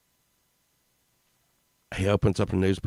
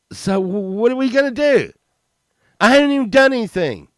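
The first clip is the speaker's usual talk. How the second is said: whiny protest; 9 dB louder, faster than usual. Retold or imitated. Kid protesting to principal.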